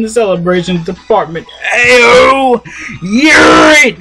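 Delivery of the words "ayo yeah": high, loud hype yell; hype shout. Real, not acted.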